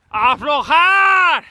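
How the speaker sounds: long drawn-out loud yell, slowed right down; shout. Real, not acted. Shouting at riding mates to ease the pace, loud drawn-out shout. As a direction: call it out to the group ahead. Calling out to riders ahead.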